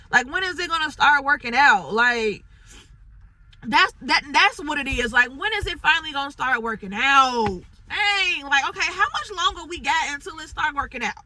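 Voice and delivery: fed-up cry of when will it work out; frustrated outburst. Real, not acted. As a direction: let the frustration burst out loud. Venting, frustration building loud.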